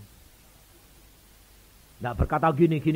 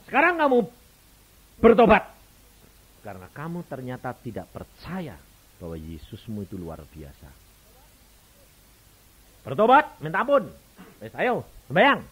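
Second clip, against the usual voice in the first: commanding call; 2 semitones higher, 10 dB louder than usual. Acted. Pastor urging the young mother to repent, loud command. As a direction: order her to repent with full force.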